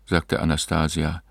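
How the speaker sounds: plain reading voice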